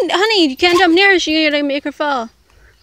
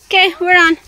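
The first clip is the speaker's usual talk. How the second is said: ordinary talk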